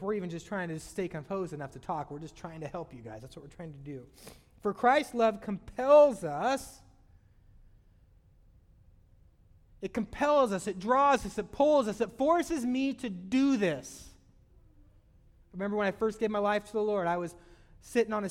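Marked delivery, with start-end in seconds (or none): 4.6-14.1 loud, slow emphatic preaching; fervent preaching. Acted. Preacher to congregation, loud slow emphatic preaching. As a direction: drive each phrase home.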